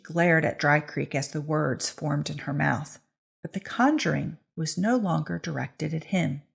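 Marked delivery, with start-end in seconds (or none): none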